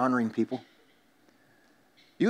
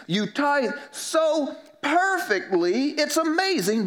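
higher, slow, sarcastic preacher's jab; slow mock amazement, pitched up; mocking rebuke; 7 semitones higher than usual. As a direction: lay on the scorn at their fussy tithing. Jesus mocks the Pharisees' tithing.

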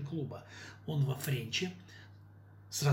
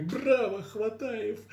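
high excited cheer; 8 semitones higher, 4 dB louder, much slower than usual. Acted. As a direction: cheer from the seats. Audience cheering.

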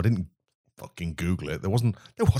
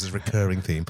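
normal talking voice

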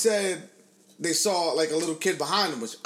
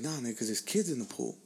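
low, soft retold undertone; imitated confidential aside; hushed explanation; 5 semitones lower, 8 dB quieter than usual. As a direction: explain it discreetly, as the friend did. Friend explains the ban discreetly.

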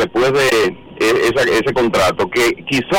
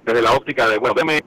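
usual speaking voice

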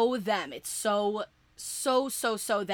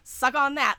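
loud, raised, cocky taunt; Cass gloating; 4 semitones higher, 4 dB louder, faster than usual. Retold or imitated. Fan voicing Cass mocking the Empty, gloating. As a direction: rattle it off as a triumphant jeer. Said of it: "And Cass being like".

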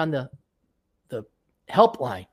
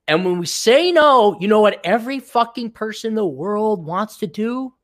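ordinary talk

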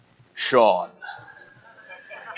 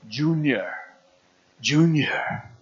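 Sean Connery as dad; slower than usual. Retold or imitated. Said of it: "he kinda leans in and he goes".

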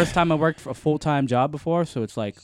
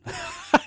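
fake breathy laugh. Shown as a demonstration. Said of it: "I got a fake laugh to this".

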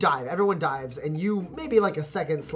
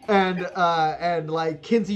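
usual speaking voice

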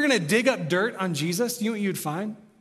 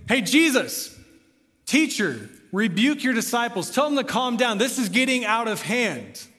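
raised, insistent acted complaint; agitated appeal; 5 semitones higher, 4 dB louder than usual. Pharisees appealing to Jesus over the crowd, raised agitated demand. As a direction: call out urgently for order. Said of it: "they appeal to the focus of the worship".

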